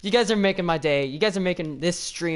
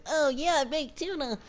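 high squeaky mock of a kid brother; mocking little brother; 9 semitones higher, slower than usual. Streamer mocking his little brother Jaden, high squeaky teasing. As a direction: mimic the annoying brother in a high voice. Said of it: "he's all like".

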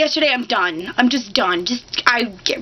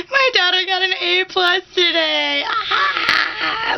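high tearful cry; mock crying; 5 semitones higher, slower than usual. Proud of daughter's grade, mock crying. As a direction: sob proudly over good news. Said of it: "Or I'm talking about".

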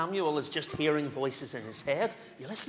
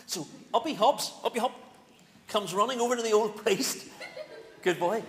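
ordinary talk, nothing marked

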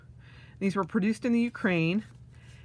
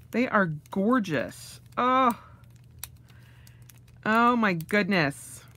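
ordinary talk, nothing marked

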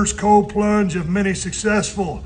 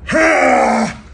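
shocked yelp; 7 semitones higher, 4 dB louder than usual. Real, not acted. First seconds in ice bath.

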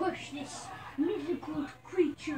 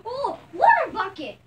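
excited call; 6 semitones higher, 8 dB louder than usual. Real, not acted.

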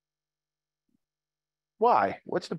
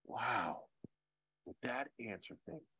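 awed hush; 5 semitones lower, 17 dB quieter than usual. Retold or imitated. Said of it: "And you said".

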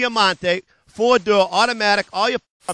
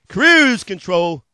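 "cruise control" stretched very slow and louder; drawn-out feature; 8 dB louder, much slower than usual. Acted. Dealer savoring the last feature for viewers, slow and drawn out.

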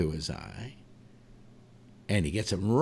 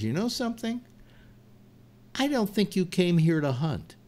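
high, knowing bear voice, softer on the question; bear punchline; 8 semitones higher, 3 dB quieter than usual. Acted. Bear tells the hunter he didn't come to hunt, high and smug. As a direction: deliver the last line as the smug bear, pitched up.

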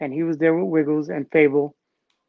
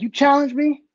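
mock-incredulous, pitched up high; imitating a cocky dancer; cocky challenge; 8 semitones higher, 3 dB louder than usual. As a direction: play the amused champion being called out. Legs answering a battle challenge.

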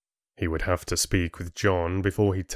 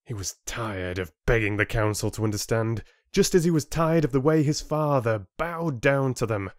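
plain reading voice

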